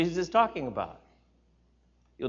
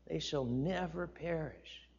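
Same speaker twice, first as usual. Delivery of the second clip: softer, slow, hushed and moved; moved quiet; 3 semitones higher, 10 dB quieter, slower than usual. Real, not acted. Pastor touched by the verse, hushed and slow. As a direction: breathe the verse as if to yourself. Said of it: "Even as I say this".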